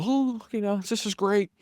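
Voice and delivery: high, sarcastic yawn of approval; mock yawning boredom. Bored husband in marriage counseling, mock yawning sarcasm. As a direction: feign delight through a pretend yawn. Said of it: "I'm over here yawning".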